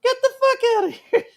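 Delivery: very high put-on voice; high-pitched exclamation. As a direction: squeal it in mock disbelief. Mimics a line in high voice.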